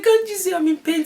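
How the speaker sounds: very high, loud wail of protest; high-pitched exasperated yell, played for comedy; mock pained scream. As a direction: re-enact screaming at your husband in labor. Retold scream at husband during labor.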